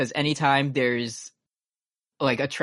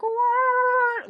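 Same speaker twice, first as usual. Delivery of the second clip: high sung trumpet wah; trumpet wah imitation. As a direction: mimic a sad trumpet. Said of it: "It's just kind of like".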